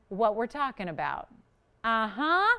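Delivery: ordinary talk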